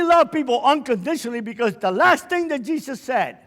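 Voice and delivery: loud, high revival preaching; preaching at full force. Acted. Preacher rousing the gentlemen in the audience, loud and fervent. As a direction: build it up, preach to the crowd.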